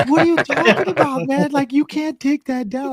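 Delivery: high, loud outraged protest; incredulous friend. Retold or imitated. Friend refusing to delete the video, high outraged disbelief. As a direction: squeal it like he's crazy.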